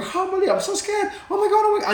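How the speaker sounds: high squeaky mock terror; mock panic. Speaker imitates someone panicking at fear, high mock terror. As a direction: play a scared wreck, way up high. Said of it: "You start going".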